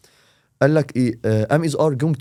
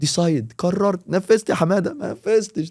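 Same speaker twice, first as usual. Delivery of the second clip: high, slow, stretched words rising higher; animated explanation; 6 semitones higher, slower than usual. Shown as a demonstration. Teacher walking students through the rule, high and slow. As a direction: pitch it up as you walk through each step. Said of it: "explaining rule animatedly".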